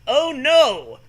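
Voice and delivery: high, loud mock alarm. Book mocking the reader, hammy loud alarm. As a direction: gasp it like a melodramatic narrator.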